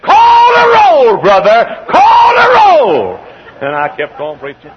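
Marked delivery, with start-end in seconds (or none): 0.0-3.2 drawn-out, high-pitched holler; congregant's exuberant shout. Retold or imitated. Old church member cheering on the preacher, long exuberant shout. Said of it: "he jumped up and he said".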